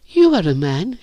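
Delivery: put-on high girl's voice; timid girl. A narrator's reading. Virginia addresses the narrator shyly, timid. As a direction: begin timidly, high-pitched.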